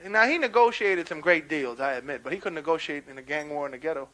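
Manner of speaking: normal talking voice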